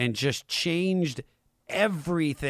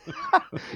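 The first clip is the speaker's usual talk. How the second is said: a short, high burst of laughter that falls away. Real, not acted. Talk show host cracking up at his own joke, high burst of laughter.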